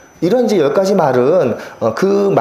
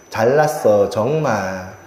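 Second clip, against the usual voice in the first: sarcastic catchphrase; 7 semitones lower, much slower than usual. Retold or imitated. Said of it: "어투나 억양 때문에 비꼬는 말".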